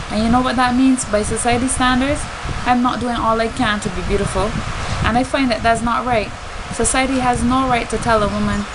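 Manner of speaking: normal talking voice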